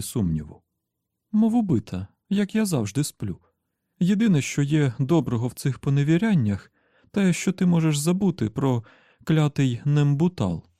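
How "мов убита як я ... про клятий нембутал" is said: high-pitched, untroubled female character voice; narrator's high voice for the woman; carefree woman. Acted. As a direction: answer breezily in a much higher voice.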